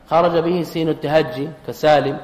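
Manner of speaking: ordinary talk, nothing marked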